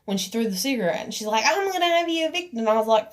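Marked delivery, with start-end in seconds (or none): 1.5-2.6 quick, high mocking imitation; mimicked threat. Quoting the woman who threatened eviction, mockingly. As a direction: mimic her threat in a fast, raised pitch.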